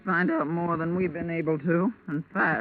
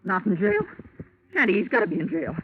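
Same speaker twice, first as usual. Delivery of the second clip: rising, louder, faster panic-edged protest; sharp outburst; 7 semitones higher, 4 dB louder, faster than usual. Acted. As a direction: snap back in mounting alarm, higher and louder. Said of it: "a voice as sharp as yours".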